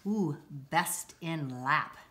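ordinary delivery

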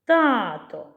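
"Тато" with a long, strong first TAA; strength of voice. Shown as a demonstration. Teacher showing stress by voice, "Тато" stretched on the first syllable. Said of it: "Силою голосу".

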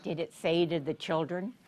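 plain reading voice

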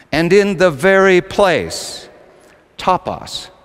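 slow, loud "very place" then breathy "topos"; slow weighty emphasis. Acted. Preacher pausing on the key phrase, slow and weighty. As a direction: lean hard on each word.

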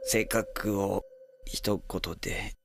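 slow, soft whispered mutter; whispering to himself. Acted. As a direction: mumble it under your breath.

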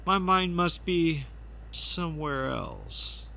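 drawn-out dreamy murmur; slow distracted trailing off. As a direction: drift off as if elsewhere. Covering up a slip.

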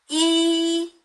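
이 held long, high and level; first tone. Shown as a demonstration. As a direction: Mandarin first tone on 이. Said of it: "한번 사성으로 해보겠습니다".

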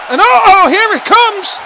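high, loud screaming call of the finisher; wrestling commentator. Acted. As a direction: erupt as the big move lands. Finisher coming, commentator erupts.

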